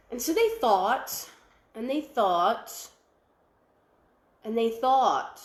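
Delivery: slowed, drawn-out thinking; slow, thoughtful pondering narration. A narrator's reading. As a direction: stretch each one out as they ponder.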